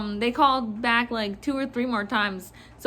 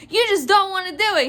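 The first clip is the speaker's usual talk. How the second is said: quick, high-pitched accusation; imitated irate customer; 7 semitones higher, 4 dB louder, faster than usual. Clerk mimics customer accusing the manager, irate. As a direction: accuse in a mock-outraged rush. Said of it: "Mainly her just screaming".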